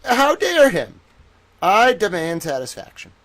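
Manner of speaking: put-on old-time reporter outrage. Reporter demanding satisfaction, high hammed-up outrage. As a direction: squeal it like a scandalized thirties newsman. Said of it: "nineteen thirties news reporter towel".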